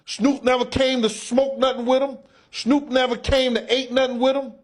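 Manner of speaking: usual speaking voice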